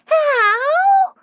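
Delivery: very high, loud howl; terrified wail. A narrator's reading. Flower girl at bay by the piano, terrified wail. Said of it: "running away in terror".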